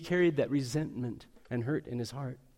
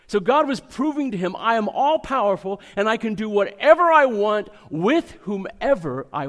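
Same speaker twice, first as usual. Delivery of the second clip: loud, high preacher's proclamation; booming pulpit declaration; preaching at full force; 5 semitones higher, 10 dB louder than usual. Acted. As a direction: thunder out God's claim of power. Preaching God's power, full force.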